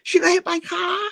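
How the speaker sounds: very high shrieky mock Chinese accent; Chinese guy. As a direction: panic in a caricatured Chinese accent. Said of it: "the Chinese sort of says".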